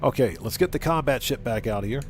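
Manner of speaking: usual speaking voice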